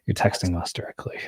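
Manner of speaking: hushed secret whisper. Pretend. Whispered aside about texting.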